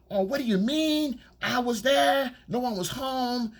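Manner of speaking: high, indignant mock outrage; mock fake anger. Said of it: "this fake anger".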